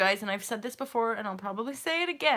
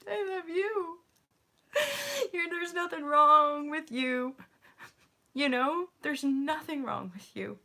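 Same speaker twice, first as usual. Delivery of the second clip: tearful self-love; 4 semitones higher than usual. Retold or imitated. Speaking lovingly to herself.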